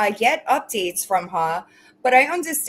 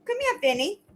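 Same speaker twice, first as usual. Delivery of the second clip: high coaxing call to the dog; sing-song come here to the dog; pet talk; 12 semitones higher, faster than usual. Real, not acted. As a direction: coo the dog over, pitched way up. Calling the pet over.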